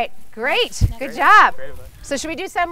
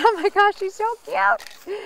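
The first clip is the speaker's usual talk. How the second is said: cooing; 9 semitones higher, slower than usual. Real, not acted. Cooing over the baby.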